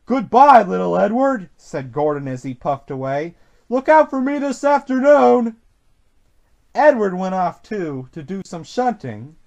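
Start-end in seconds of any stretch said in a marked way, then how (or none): 0.0-1.5 lightly voiced engine calling goodbye, louder start; engine's farewell. A narrator's reading. Gordon's haughty farewell. 3.7-5.6 high, loud parting call; lightly voiced boast on departing. A narrator's reading. Gordon calls while puffing away.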